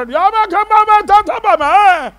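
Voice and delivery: loud, high wordless bleating; mute straining sounds. Retold or imitated. Struck-dumb priest trying to talk, loud high bleats. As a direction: try to speak but only strain out sounds.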